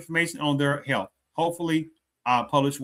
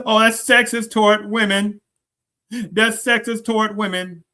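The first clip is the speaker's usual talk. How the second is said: mock whiny outrage, pitched higher; mocking complaint; 6 semitones higher, 5 dB louder than usual. Vlogger mocking those who cry sexism, hammed-up. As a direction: parody the offended complainers, louder and higher. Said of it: "how much sexism talk".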